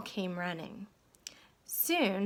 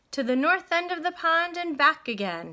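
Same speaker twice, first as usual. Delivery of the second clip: loud, high call over the crowd; referee shouting; 6 semitones higher, 5 dB louder than usual. A narrator's reading. Referee shouting the race course to the boats. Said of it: "shouted the referee".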